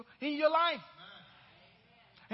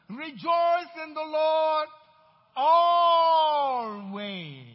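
slow, loud preaching shout, louder on always; scripture yelled out; 4 semitones higher, 11 dB louder, much slower than usual. Acted. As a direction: yell it back at them, drawing it out. Repeated preached refrain.

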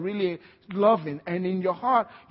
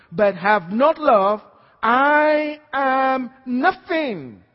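louder, climbing to a shout, slowing at the end; full-force preaching; 4 semitones higher, 7 dB louder than usual. Acted. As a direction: thunder the scripture at the congregation. Reads scripture at full force.

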